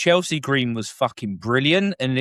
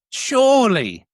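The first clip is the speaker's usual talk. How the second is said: incredulous appeal; 11 semitones higher, 4 dB louder than usual. Real, not acted. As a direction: appeal loudly, pitch swooping down. Pleading for a nod from Ospreay.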